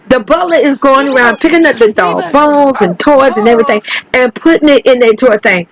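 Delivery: ordinary talk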